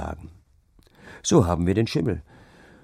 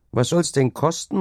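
higher-pitched calling question; called-out question; 9 semitones higher, 3 dB louder than usual. A narrator's reading. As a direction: call the question over to the seller. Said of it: "rief ich". (mit hoher Stimme gerufene Frage)

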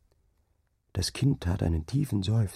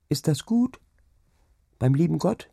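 put-on asking child; 10 semitones higher than usual. Child asks dying mother's fate.

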